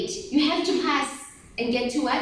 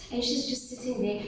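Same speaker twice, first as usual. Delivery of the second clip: usual speaking voice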